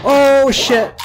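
loud, high startled swearing; alarmed cry. Real, not acted. Player suddenly attacked in game, loud alarmed curse. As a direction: blurt it out at sudden danger.

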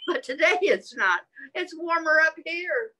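ordinary delivery

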